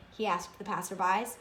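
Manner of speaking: usual speaking voice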